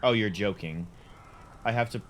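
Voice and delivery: ordinary delivery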